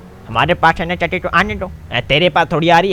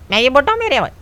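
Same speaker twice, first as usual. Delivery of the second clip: much higher, outraged question; old man offended at being called old, high indignant; indignant disbelief; 11 semitones higher, 4 dB louder, much faster than usual. Acted. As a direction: squeak it out, offended.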